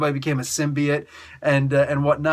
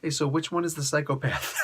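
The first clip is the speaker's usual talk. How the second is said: a hushed, much softer side question; sly aside; 7 dB quieter than usual. Retold or imitated. Moon Knight leaning over to Jericho Drumm, hushed. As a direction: lean in and ask it under your breath.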